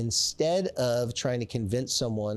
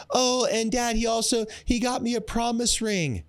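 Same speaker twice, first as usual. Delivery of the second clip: put-on squealing teenage girl voice, much higher; gushing teen daughter; 10 semitones higher than usual. A teenage daughter gushing to her dad, in a squealing high voice. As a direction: gush like a giddy fourteen-year-old. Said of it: "imagine a fourteen-year-old girl".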